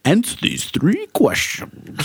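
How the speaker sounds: troll voice. Pretend. Troll voice joke.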